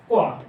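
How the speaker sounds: क्वा said as one gliding syllable, kwa, firm and falling; compound consonant kwa. Shown as a demonstration. Teacher answering her own question to the class, firmly saying क्वा. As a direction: blend k and w into क्वा, short and falling. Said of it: "इस type से".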